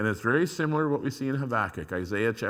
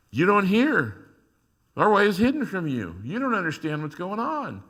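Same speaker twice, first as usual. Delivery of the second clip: much higher, louder indignant complaint; accusing God; 9 semitones higher, 7 dB louder than usual. Acted. Believers voicing accusations at God, indignant and loud.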